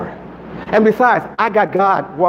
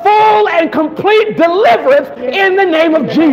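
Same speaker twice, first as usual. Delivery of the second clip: preaching; 10 semitones higher, 4 dB louder, slower than usual. Acted. Preaching prayer at full force.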